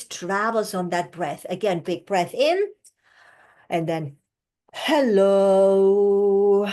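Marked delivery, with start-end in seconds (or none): 4.7-6.7 slow monotone hello. Shown as a demonstration. Coach demonstrating the slow hello exercise. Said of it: "try the same exercise with the word hello".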